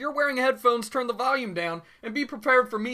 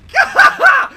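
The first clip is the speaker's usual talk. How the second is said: pained shriek laugh; 10 dB louder than usual. Real, not acted. Laughing through the pain.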